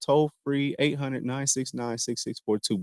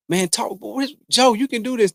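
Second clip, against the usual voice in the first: higher-pitched pep talk to himself; encouraging inner voice, pitched up; 8 semitones higher, 4 dB louder than usual. Retold or imitated. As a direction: talk yourself up like a coach in your head. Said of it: "That my inner voice is".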